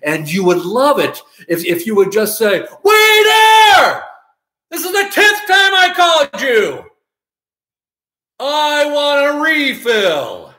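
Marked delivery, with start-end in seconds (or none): 2.6-10.5 loud, high, drawn-out demanding yell; hammed-up yell. Diner calling a waiter the tenth time, hammed-up yell. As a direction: bellow it like a fed-up customer.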